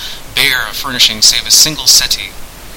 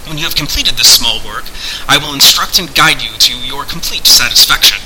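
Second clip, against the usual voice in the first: raised, declaiming voice of a hidden master; imperious sorcerer; 4 semitones higher than usual. Acted. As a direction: set out the terms with grand formality. Voices Pendulum setting terms.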